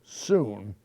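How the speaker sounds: ordinary delivery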